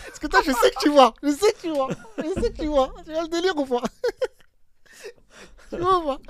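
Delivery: can barely get the words out for laughing; cracking up retelling the Spanish mix-up. Real, not acted. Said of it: "j'ai éclaté de rire".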